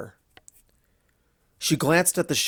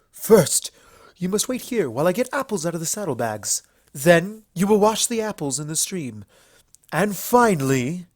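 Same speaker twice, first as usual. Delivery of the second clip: melodramatic villain queen; 5 semitones higher than usual. Acted. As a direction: ham up a mock water tyrant for laughs. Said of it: "over-exaggerated melodramatic".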